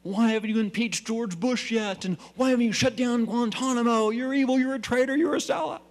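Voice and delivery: high, loud angry heckling; mock crowd yell. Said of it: "they're yelling".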